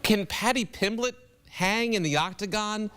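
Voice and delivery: usual speaking voice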